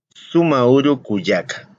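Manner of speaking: "sumauru kuyaka" said slowly; slow Aymara greeting. Shown as a demonstration. Teacher models the sister greeting slowly. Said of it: "que sería".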